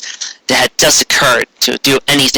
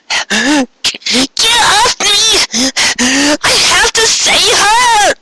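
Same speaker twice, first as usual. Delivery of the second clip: much higher, slow desperate cry; Dizzy crying out; much slower than usual. A narrator's reading. Dizzy pinned, desperate to save her friend, high cry. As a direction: fight to get free to save her. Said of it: "Dizzy cried out".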